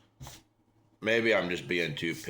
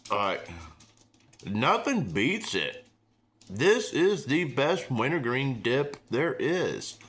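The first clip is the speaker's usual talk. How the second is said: excited; 5 semitones higher, slower than usual. Real, not acted. Raving about favorite dip.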